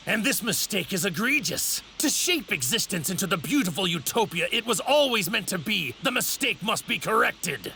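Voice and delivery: high, fervent sermon of a self-made god; zealous villain oratory. Acted. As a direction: preach the purge with rising conviction. Villain's zealous speech against mortals.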